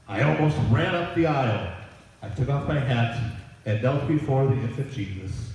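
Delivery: ordinary delivery, nothing marked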